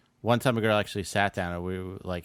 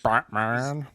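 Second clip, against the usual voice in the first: higher, put-on villain voice; Bane; 5 semitones higher, 4 dB louder than usual. Host showing how the villain sounds, put-on villain voice. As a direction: demonstrate the movie villain's odd voice. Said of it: "It was more ... like".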